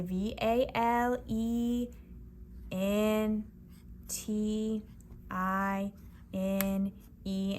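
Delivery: slow, letter by letter; level letters with long gaps; spelling out. Shown as a demonstration. Teacher spelling Valentine while writing it, slowly. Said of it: "I spelled it as I went".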